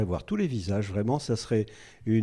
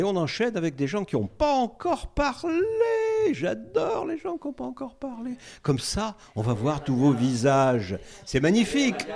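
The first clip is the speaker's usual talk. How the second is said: excited host; 11 semitones higher than usual. Real, not acted. Host cheerfully urging new speakers.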